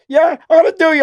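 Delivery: high, loud imitated threat; imitating the geezer shouting a threat; mock shout.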